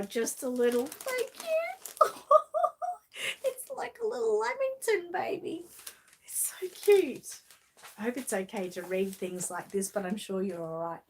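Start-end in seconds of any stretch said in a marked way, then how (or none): 0.8-7.4 higher teary laugh-cry, voice breaking; touched to tears, giggling through it; a laughing cry over a sweet gift. Real, not acted. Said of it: "So you've made me teary".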